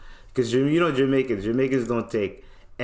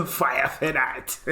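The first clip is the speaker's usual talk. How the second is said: slow mock Jamaican, laughing through it; Jamaican accent; 3 semitones higher, slower than usual. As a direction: drag out fire for that in patois. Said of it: "He goes".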